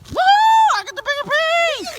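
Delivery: high triumphant cheer; excited cheer. Real, not acted. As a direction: crow it out in victory. Wins wishbone pull, cheering.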